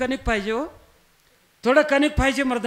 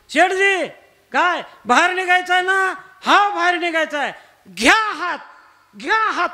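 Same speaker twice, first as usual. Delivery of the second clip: higher, raised acted call; 5 semitones higher, 3 dB louder than usual. Neighbour to miser in the pit, calling down. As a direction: call out to coax someone up.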